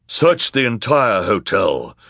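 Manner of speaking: loud, slow villain's command; villainous man. A narrator's reading. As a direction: order a search like a villain. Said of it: "said the villainous man".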